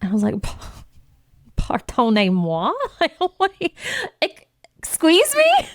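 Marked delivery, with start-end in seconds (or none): 0.4-2.7 haughty French pardonnez-moi, slow; mock French. Mock offended French reply. 3.0-5.7 high, laughing "excuse me?"; offended disbelief. Retold or imitated. Offended disbelief at copywriter's tip.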